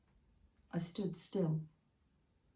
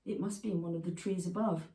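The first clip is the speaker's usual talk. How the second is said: plain reading voice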